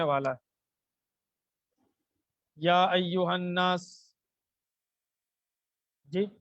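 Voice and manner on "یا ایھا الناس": slow drawn-out recitation; drawn-out Quranic chant. Shown as a demonstration. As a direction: recite it slowly, stretching the words. Teacher recites verse slowly.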